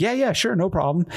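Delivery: high, breezy yes on the phone; casual agreement. Retold or imitated. Agreeing to meet the couple at the pizzeria, casual agreement. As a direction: agree easily, pitched well up.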